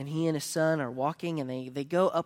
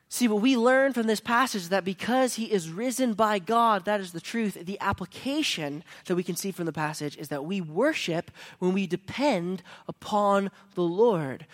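louder closing exhortation; preaching; 3 semitones higher, 5 dB louder than usual. Acted. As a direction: press the application on the church. Closing application preached loudly.

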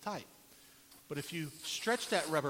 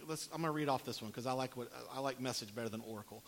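ordinary delivery

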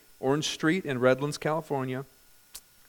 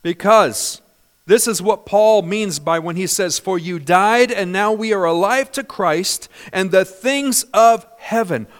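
louder, full-force preaching of scripture; 4 semitones higher, 8 dB louder than usual. Real, not acted. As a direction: preach it out loud to the congregation.

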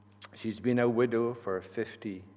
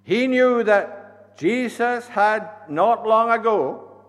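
preaching; 2 semitones higher, 12 dB louder than usual. Acted. Preaching at full force.

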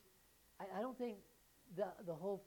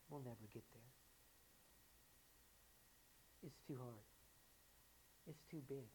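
softer, defeated and deflated; discouraged believers; 11 dB quieter than usual. Acted. Believers to themselves, mild mock defeat. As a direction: sigh it out like giving up.

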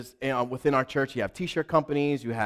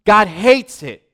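loud, high preaching from the pulpit; preacher's fervent loud sermon voice; full-force preaching; 8 semitones higher, 13 dB louder than usual. Acted. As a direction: thunder it at the congregation.

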